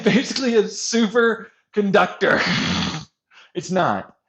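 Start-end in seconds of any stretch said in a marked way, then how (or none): none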